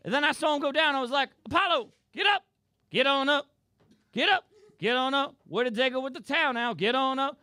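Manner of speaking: high, loud chanting "get on up". Retold or imitated. [name] cheering on Apollo.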